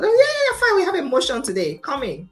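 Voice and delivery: high-pitched, eager "yeah, yeah" falling to casual; court clerk on phone. Retold or imitated. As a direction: imitate an obliging court official, starting high and loud. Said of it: "calling courts and say".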